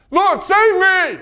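loud, slow desperate plea for rescue; desperate cry. Acted. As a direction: cry it out like a drowning man. Preacher acts out cry for help.

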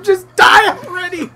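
high, loud exasperated mock yell. Player to the worst game AI, exasperated yelling. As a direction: holler at a hopeless teammate. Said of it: "I'm always yelling at him".

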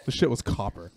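usual speaking voice

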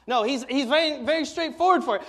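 ordinary talk, nothing marked

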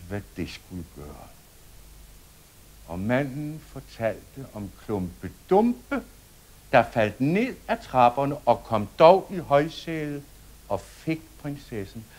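ordinary delivery, nothing marked

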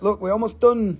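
ordinary delivery

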